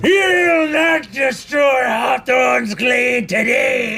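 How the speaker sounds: high, loud, slow defiant shout; fairy defiance. Acted. Fairy defender to the redcap, high defiant shout. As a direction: stand fast for the glade. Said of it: "fairy defender shouts".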